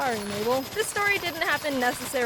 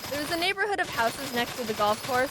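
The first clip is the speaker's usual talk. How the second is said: ordinary talk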